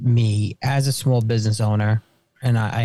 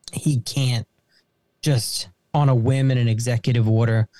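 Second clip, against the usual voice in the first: ordinary delivery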